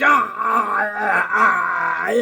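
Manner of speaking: hammed-up yelps of pain; comic pained yelps; mock cries. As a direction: cry out like you're getting hit. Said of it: "He's like".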